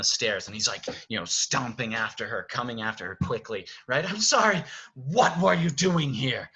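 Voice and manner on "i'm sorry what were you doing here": loud, high, urgent stage lines; acted quarrel. Beast stomps after Belle down the stairs, loud acted confrontation. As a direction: cry it out mid-chase.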